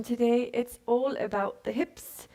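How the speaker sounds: playful faux-British lilt; pseudo British accent. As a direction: slip into a mock British accent. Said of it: "pseudo British accent there".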